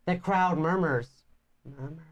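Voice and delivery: storybook reading voice; story narration. Acted. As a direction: read it like a fantasy tale. Reads game story narration.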